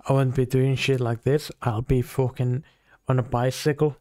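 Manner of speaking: ordinary delivery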